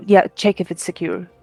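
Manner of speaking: ordinary delivery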